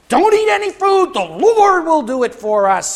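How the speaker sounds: high, loud barked order; Saul's stern command. Acted. Saul ordering his hungry soldiers, loud and high. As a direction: bark it like a harsh king. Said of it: "Saul was saying".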